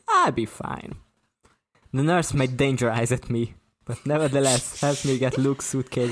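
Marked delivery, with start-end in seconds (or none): 0.1-1.0 high-pitched brush-off, sliding down; pitched-up, scoffing reassurance; incredulous dismissal. A narrator's reading. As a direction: wave away the worry in disbelief. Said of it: "Luke blew out incredulously".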